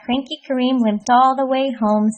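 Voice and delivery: ordinary delivery, nothing marked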